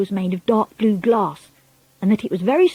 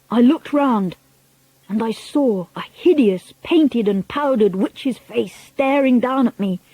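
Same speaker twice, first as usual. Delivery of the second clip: plain reading voice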